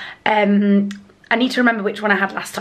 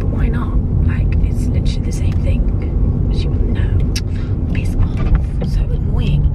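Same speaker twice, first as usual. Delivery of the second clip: whisper; 4 dB louder than usual. Real, not acted. Whispered complaint.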